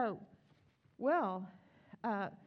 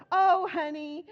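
put-on higher and louder, a kid's cheeky reply; child voice; 8 semitones higher, 11 dB louder, slower than usual. Daughter answering mother, high cheeky kid voice. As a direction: toss it off like a bold toddler. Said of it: "And she's like".

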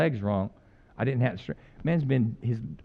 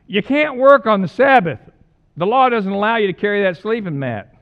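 loud, higher scolding of the leaders; 4 semitones higher, 7 dB louder than usual. Retold or imitated. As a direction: scold him like a stern religious leader. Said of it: "they said to the man".

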